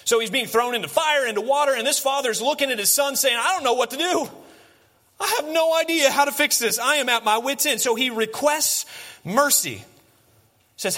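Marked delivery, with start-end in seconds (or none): 3.4-7.8 high-pitched, exasperated plea; loud, high, helpless outburst; desperate father. Acted. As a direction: act a parent at wit's end, pitched way up. Desperate father voiced at wits' end.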